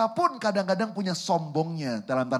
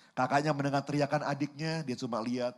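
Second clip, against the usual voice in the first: ordinary talk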